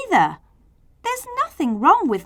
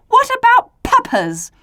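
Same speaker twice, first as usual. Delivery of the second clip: pleading; 14 semitones higher, 6 dB louder than usual. Acted. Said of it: "he pleaded".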